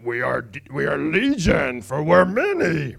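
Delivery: put-on demonic voice, stretching the last words; theatrical demon growing in pitch and volume. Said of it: "the answer by the demon".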